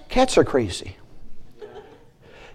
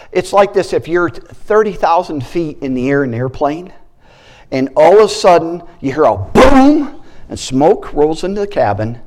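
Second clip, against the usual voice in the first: normal talking voice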